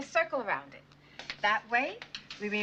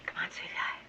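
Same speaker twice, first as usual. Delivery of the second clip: whispered coaxing; 6 dB quieter than usual. Acted. Coaxing child softly.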